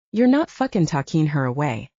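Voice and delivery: plain reading voice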